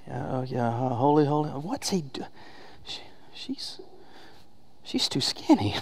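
stammering, near-whispered mock disbelief; hushed dismay. Onlooker in heaven watching a spouse remarry, hushed mock dismay. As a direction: mutter haltingly, very softly, as if peering down.

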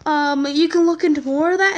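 ordinary talk, nothing marked

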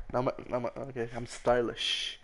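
ordinary delivery

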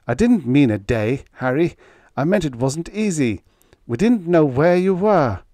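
high, pleading excuses; almost whining. A narrator's reading. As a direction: plead your case, voice pushed up. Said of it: "whining almost".

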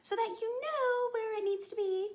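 high, lilting princess voice. Shown as a demonstration. Teacher showing students where to place speech, princess voice. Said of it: "in a princess voice as you're speaking".

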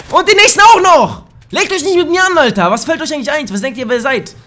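high, loud gloating at the enemies; real triumphant trash talk; triumphant taunting. Real, not acted. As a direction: crow over the kills, slowing the first words. Boasting after a double kill.

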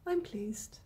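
usual speaking voice

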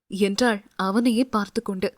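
plain reading voice

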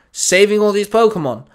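barking back. Acted. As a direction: bark back at the captain. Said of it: "Baz barked back".

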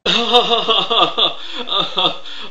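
loud burst of real laughter; cracking up hard; big laugh. Real, not acted. Listener cracks up at the Sagan impression, loud laugh. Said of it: "you slay me".

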